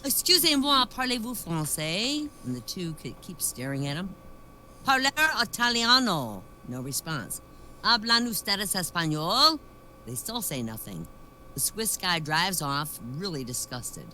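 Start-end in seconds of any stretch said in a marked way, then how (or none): 0.0-2.3 higher, louder French question; French phrase. Retold or imitated. Swiss man trying the Englishmen in French. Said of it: "he says". 4.8-6.4 Italian phrase. Retold or imitated. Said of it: "he says". 7.8-9.6 Spanish phrase. Retold or imitated. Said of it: "he says".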